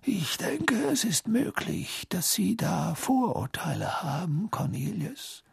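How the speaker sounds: soft, slow, near-whispered rebuke; gently chide, unhurried; calm. Acted. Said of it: "weiterhin gelassen".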